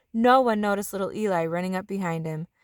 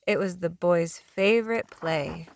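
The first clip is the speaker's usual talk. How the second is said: plain reading voice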